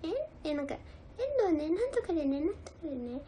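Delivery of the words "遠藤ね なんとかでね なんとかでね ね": higher chatty mimic fading to a breathy hush; a lightly voiced nagging Endo, trailing off; imitating Endo. Doing the staffer Endo for fans, a light mimic that fades out. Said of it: "遠藤のモノマネとかできますよ".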